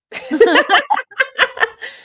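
high, loud put-on cackle. Shown as a demonstration. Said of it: "the laugh, especially, once I nailed the laugh".

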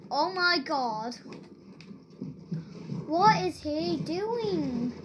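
ordinary talk, nothing marked

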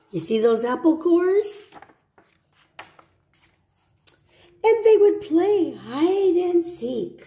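gentle storybook read; reading to children. Acted. Teacher reading a picture book to children.

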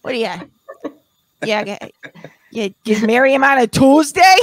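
put-on Boston accent, teasing. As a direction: lay on the Boston accent for a playful shout-out. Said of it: "I'll say something to her in the accent".

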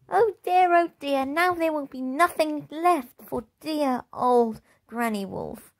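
high wailing little wolf; wailing cub. Acted. Little wolf cub wailing over his emptied basket, high and tearful. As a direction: wail it in a high small voice. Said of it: "wailed little Wolfie".

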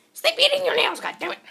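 high, loud squawked telling-off; mock scolding yell. Vlogger scolding her for eating her nails, mock yell. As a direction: yell it in exasperated, squeaky outrage.

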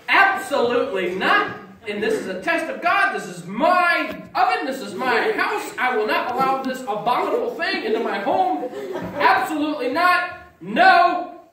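loud, high indignant refusal; hammed-up outrage, shouting down the phone; mock righteous fury, laughing through it; zealous refusal.

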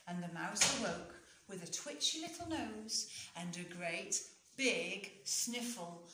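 usual speaking voice

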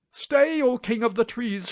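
high, loud plea to a king; crying out. A narrator's reading. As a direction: call aloud imploringly, voice pitched well up. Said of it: "cried the poor prince".

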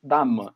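"dá-me" as "DA-muh", final vowel reduced; European Portuguese pronunciation. Shown as a demonstration. Repeating the European form for listeners, "dá-me" with unstressed, muffled "me". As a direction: let "me" in "dá-me" fade into a neutral vowel. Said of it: "esse me do português europeu não é o mesmo me".

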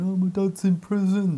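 high-pitched mock wail; imitated crying. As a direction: put on a child's tearful cry. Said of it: "crying, going".

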